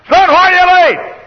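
high, slow, drawn-out mock yell; scolding mother. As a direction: yell over the child's stammering. Mother cuts off stammering son.

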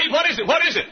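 high, loud urgent question; startled, pressing for an answer; alarmed. Retold or imitated. Preacher to husband on phone: alarmed, high and loud.

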